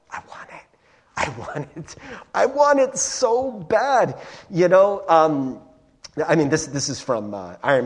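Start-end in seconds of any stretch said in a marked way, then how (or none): 0.1-2.0 breathy whisper rising to a low murmur; hushed craving. Acted. Acted craving, building from whisper. 2.3-4.2 higher, slow, drawn-out longing; intense craving. Acted. Acted craving at its peak.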